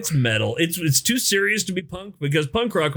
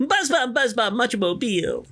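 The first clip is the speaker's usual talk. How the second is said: put-on old lady voice; 10 semitones higher, slower than usual. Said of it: "He does a little old lady voice".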